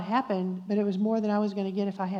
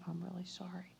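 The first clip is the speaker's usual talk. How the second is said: very soft retold apology; near-whispered, mildly sorrowful; tearful apology; 15 dB quieter than usual. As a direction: apologize under your breath. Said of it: "I start crying".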